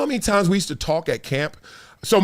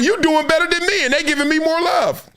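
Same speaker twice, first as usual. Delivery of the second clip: 1 mock high voice; 11 semitones higher than usual. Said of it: "I was like".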